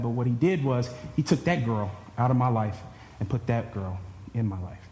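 usual speaking voice

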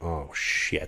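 low, hushed sinking curse; acted dismay. Player told his equipment is lost, quiet dismay. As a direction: let it drop quietly, deflated.

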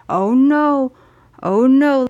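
high, loud, drawn-out "oh no"; mock alarm. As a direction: imitate people overreacting with exaggerated concern. Mimics people's overblown worried reaction.

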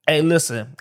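normal talking voice

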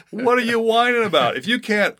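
high-pitched incredulous scolding; mock exasperated rebuke. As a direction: challenge a complainer, half in jest. Acted rebuke to the complainer.

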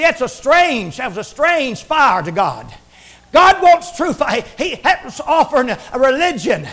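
usual speaking voice

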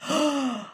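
short acted gasp. Anansi spots the coconuts, hammed-up gasp. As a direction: gasp in mock amazement.

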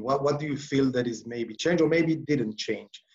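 ordinary talk, nothing marked

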